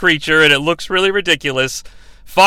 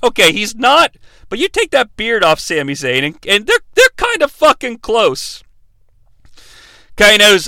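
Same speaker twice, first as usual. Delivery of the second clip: ordinary talk, nothing marked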